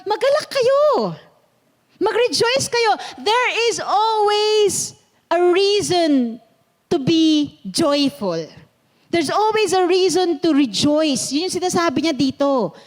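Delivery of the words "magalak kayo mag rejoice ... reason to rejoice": high, slow voicing of the apostle urging joy; voicing Paul's exhortation. Pastor voicing Apostle Paul urging people to rejoice, exhorting. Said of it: "really saying to the people".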